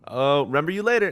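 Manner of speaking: in-game character voice. Pretend. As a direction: deliver it like a game tagline. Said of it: "the in-game character voice".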